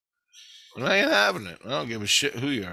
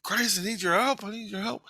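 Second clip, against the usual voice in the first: pleading; 10 semitones higher than usual. Retold or imitated. Retells pleading to Christ for help.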